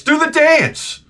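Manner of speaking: mock woman nagging. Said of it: "And this woman says to me".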